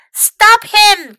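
high, loud, alarmed cry; Pinky crying out to stop Mac; cried out. Acted. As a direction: urge them to stop him. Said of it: "cried Pinky".

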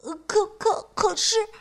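high, nervous stutter on 可; stammering. Acted. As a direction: trip over the words in fright. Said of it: "结结巴巴地说".

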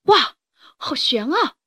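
loud high wow, then easing; startled relief. Acted. Rooster just missed by the leaping horse, startled exclamation. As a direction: exclaim at a near miss.

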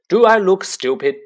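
loud, high furious snarl. Acted. Uncle snaps at Harry over breakfast, loud snarl. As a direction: spit the question out in contempt. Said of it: "snarled Uncle Vernon".

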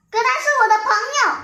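a lamb stamping its foot, shrill and quick; stamping protest. A narrator's reading. Lamb protesting to mother, fast high outburst. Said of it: "小羊跺着脚抗议".

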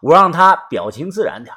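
usual speaking voice

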